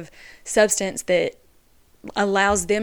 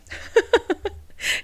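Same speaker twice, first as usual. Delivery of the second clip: giggle; 12 semitones higher than usual. Real, not acted. Laugh after naming Sarah.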